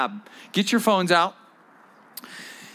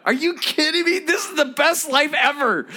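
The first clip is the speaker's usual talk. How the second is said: thrilled disbelief; 8 semitones higher than usual. Retold or imitated. New believers thrilled after faith walk.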